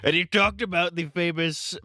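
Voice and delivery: high, put-on presidential voice; Trump impression. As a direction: do a mock Trump. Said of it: "doing a Trump impression".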